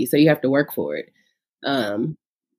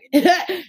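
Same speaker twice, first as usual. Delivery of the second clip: bright laugh; 12 semitones higher than usual. Real, not acted. Laughs at her own story.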